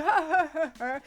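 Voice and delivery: separate, hammed-up laughing huhs; imitated laugh. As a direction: act out someone's huh-huh laugh. Imitates Tim Robbins laughing.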